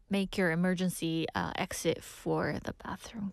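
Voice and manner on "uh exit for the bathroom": whispering. Real, not acted. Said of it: "whispered punchline".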